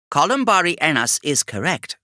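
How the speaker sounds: a tech-priest chiming in; other Magos. Acted.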